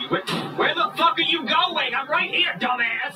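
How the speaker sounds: high indignant calling out; angry. Acted. Man calling after the passing driver, angry. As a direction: yell after someone driving off.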